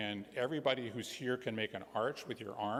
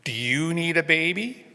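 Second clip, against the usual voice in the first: high, drawn-out polite question; Robin asking; 8 semitones higher, 11 dB louder, slower than usual. A narrator's reading. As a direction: stretch it out, pitch climbing to the end. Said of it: "So she says".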